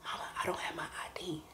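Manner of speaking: very soft, breath-only whisper; whispered confession. Retold or imitated. Confessing the missing I D to mama, whispered. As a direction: own up to it in a whisper. Secret confession to mother, retold.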